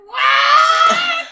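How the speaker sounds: high, loud shout of disbelief; shocked shout. Retold or imitated. Reacting to the anime's flirting, a high shocked shout. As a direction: yelp it in total shock.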